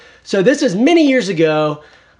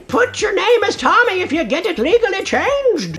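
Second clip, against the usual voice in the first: put-on teacher with an accent; 13 semitones higher than usual. A boy's teacher telling him he can't be Tommy, in a high mock accent. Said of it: "And she said".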